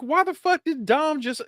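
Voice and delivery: high-pitched, incredulous rant; worked-up, disbelieving complaint; exasperated. Real, not acted. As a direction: protest in baffled disbelief, voice way up. Exasperated complaint about Dom's role.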